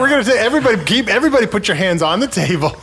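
loud, high, scrambling to regain order; shouting over the room. Real, not acted. Host shouting at the guests to stop playing. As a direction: raise your voice to stop the game.

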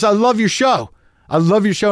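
high, gushing fan voice; lit up. Retold or imitated. Movie star praising the host's show, lit up. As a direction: gush it high like a starstruck fan. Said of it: "he was just lit up about it".

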